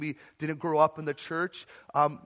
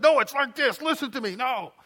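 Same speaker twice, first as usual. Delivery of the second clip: domineering lecturer; 8 semitones higher, 5 dB louder than usual. Retold or imitated. Domineering preacher controlling conversation.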